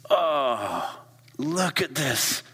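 lifted, rapturous oohs; delighted wonder. Pretend. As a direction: gush over the sunshine. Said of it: "You go".

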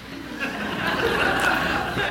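airy, unvoiced high laugh; a quick laugh at his own dark thought; breathy laugh. Real, not acted. Pastor laughing to the audience at his own thought, breathy laugh.

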